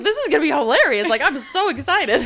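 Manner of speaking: excited. Retold or imitated. Bragging to her friend in the car, fast. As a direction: gush about the joke. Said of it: "I was like".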